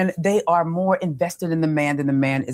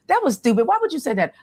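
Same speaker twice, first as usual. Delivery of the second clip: mock blunt scolding; 7 semitones higher, faster than usual. Shown as a demonstration. Coach mimicking a harsh reply to a man, mock scolding. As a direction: play the blunt way she avoids. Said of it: "but I don't say it like".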